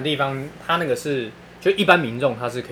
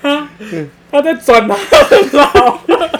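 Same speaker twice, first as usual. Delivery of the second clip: laughing; 13 dB louder, much slower than usual. Real, not acted. Punchline amid laughter.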